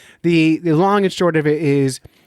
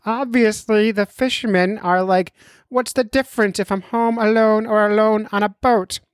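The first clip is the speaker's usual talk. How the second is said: mock doctor voice; 8 semitones higher than usual. Podcaster voicing his doctor friend's message, mock doctor voice. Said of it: "I'll do the voice work for him".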